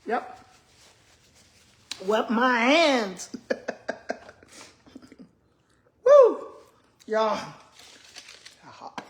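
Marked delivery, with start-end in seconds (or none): 2.1-3.3 loud, slow, drawn-out words; slow and emphatic. Real, not acted. 6.1-6.5 very high, loud laughing woo; laughing whoop. Real, not acted. Laugh then whoop from heat.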